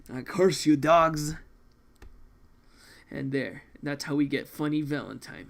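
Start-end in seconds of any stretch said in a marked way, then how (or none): 0.1-1.4 angry curse. Acted. Condemned man curses his captors.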